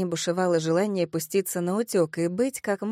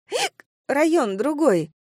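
high hiccup, then slow slurred words; tipsy hiccuping girl; 7 semitones higher, 4 dB louder, slower than usual. Acted. Drunk Kelsey chimes in to her friends, hiccuping. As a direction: play a tipsy girl cut short by hiccups.

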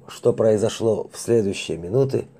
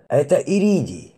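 high triumphant cry; 5 semitones higher than usual. Acted. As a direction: announce it like a victory. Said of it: "победно заявил оператор анализатора". (победный возглас)

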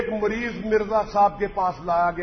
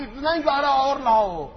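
mock pompous command; 7 semitones higher, 4 dB louder than usual. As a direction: ham up the self-important order. Mocking the founder's order.

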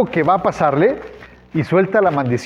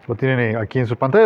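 ordinary delivery

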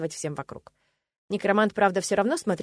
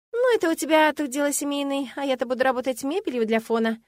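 higher-pitched, mildly scornful comeback; snorted retort; 7 semitones higher, 3 dB louder than usual. A narrator's reading. Woman to necromancer about being background furniture, scoffing. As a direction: toss it back with a light scoff. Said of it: "фыркнула в ответ".

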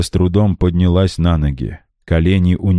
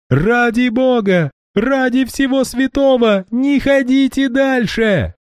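very high, slow frantic pleading; desperate pleading. A narrator's reading. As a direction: beg them in terror to stop. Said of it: "вскричала Ванда". (отчаянная мольба)

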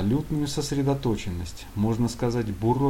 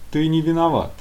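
official tone; 6 semitones higher, 5 dB louder than usual. Acted. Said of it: "прозвучало официальным тоном".

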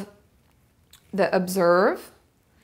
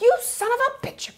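much higher, mock yell at the meter maid; mock angry outburst. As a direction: ham up losing it at the meter maid.

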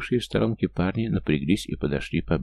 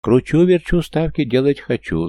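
high, loud sing-song hawker call; loud carnival barker; 8 semitones higher, 8 dB louder than usual. Acted. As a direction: belt it out to draw a crowd. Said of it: "зазывал народ".

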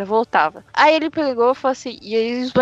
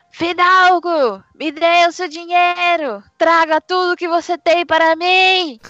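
mock yelling; 5 semitones higher, slower than usual. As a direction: drag out each demand as a yell. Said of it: "ele começa a gritar".

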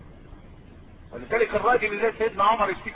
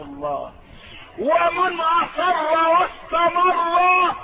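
slow, raised, declamatory hadith recital; solemn preaching; 3 semitones higher, 4 dB louder, much slower than usual. Acted. Preacher recites the Prophet's answer, slow and solemn. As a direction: intone each phrase like a pulpit proclamation.

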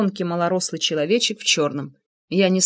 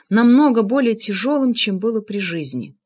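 plain reading voice

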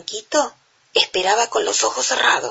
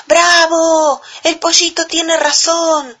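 high, loud cheering animals; excited cheer; 9 semitones higher, 4 dB louder, slower than usual. A narrator's reading. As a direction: cheer it like a happy crowd. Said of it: "dijeron los animales".

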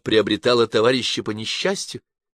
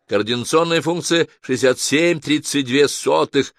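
loud, higher-pitched official readout; raised announcer tone for reciting scores; voice reading out results; 7 semitones higher, 4 dB louder than usual. A narrator's reading. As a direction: announce the figures like a ringing automated voice. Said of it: "Голос зачитывал".

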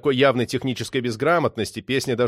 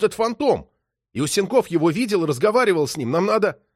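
raised, higher voice, pressing on; urgent excitement; 7 semitones higher than usual. A narrator's reading. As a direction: pour out the discovery with burning urgency. Artyom bursts in with a discovery.

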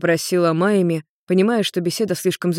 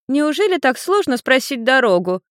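put-on high, teasing child voice; giggling little girl; 7 semitones higher than usual. A narrator's reading. Little girl taunts Ian about asking directions, giggly teasing. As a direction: needle him in a high girlish voice. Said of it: "хихикала Люся".

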